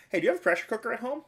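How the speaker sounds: a playful squeaky quote of himself; high mock voice. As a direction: fire off a bright high question. Asking neighbor's son, acted quote.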